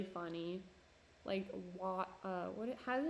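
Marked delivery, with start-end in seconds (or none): none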